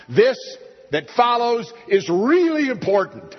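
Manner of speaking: high, loud burst of emphasis; preacher's loud emphatic cry. Real, not acted. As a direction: announce that this matters, loudly. Preacher stresses importance.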